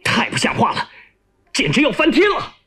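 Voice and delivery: high-pitched, indignant exclamation; angry outburst. Acted. Chief of staff storming out of the yard, angry outburst. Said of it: "怒气冲冲地".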